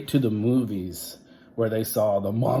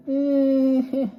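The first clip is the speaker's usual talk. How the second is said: put-on kids' chorus; 8 dB louder than usual. Kids answer sheepishly.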